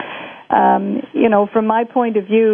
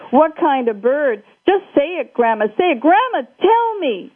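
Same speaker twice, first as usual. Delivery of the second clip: higher, pushing harder each time; frustrated demand; 7 semitones higher than usual. Acted. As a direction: demand the answer, close to shouting. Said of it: "I'm sorry I shouted".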